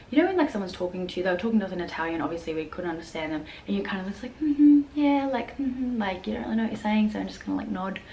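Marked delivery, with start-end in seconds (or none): 4.4-6.0 high-pitched polite mm-hmms; mock agreeable humouring; feigned understanding. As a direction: play along as if you follow. Acts out nodding along without understanding.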